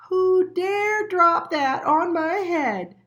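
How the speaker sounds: high, slow, furious squeaky shout; a tiny mole yelling up at the sky; angry shout. A narrator's reading. Little Mole shouting angrily at whoever dropped it. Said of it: "shouted Little Mole angrily".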